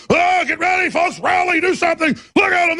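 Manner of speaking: high, loud mock alarm call to the crowd; rallying call. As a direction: sound the alarm like a town crier. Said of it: "I go".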